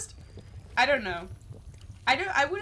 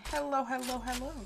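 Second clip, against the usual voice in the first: excited greeting; 6 semitones higher than usual. Real, not acted. Greeting a player in game.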